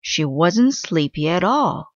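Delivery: ordinary delivery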